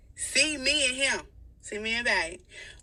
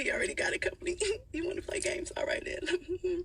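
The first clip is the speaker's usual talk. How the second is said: laughing whisper; 9 semitones higher, 4 dB quieter than usual. Real, not acted.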